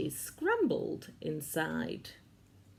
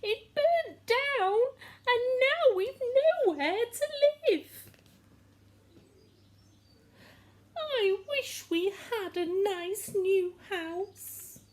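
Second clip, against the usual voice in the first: squeaky mouse; 4 dB louder than usual. A narrator's reading. Mother Mouse keeps squeaking.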